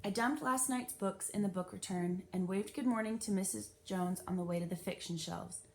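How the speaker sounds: usual speaking voice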